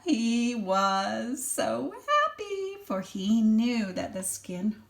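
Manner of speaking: put-on lively read-aloud for a small child; expressive storybook reading; story narration. A narrator's reading. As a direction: read it like a bedtime picture book. Reads picture book to child.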